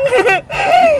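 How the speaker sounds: high gleeful cackle; mischievous giggle. Acted. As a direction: laugh high at your own prank. Prankster delighted with lipstick job. (matinis na pilyong halakhak)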